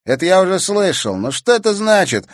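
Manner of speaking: high, loud burst of irritation; riled and loud; angry retort. A narrator's reading. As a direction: demand an answer, angrily raising the voice. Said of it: "разозлился Бельцев".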